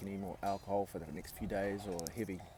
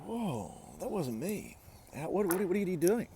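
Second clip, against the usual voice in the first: higher-pitched, stumbling mock bewilderment; startled retelling; 8 semitones higher than usual. Client to healer after his chest inhales by itself, startled. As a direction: replay being taken aback, tripping over the question.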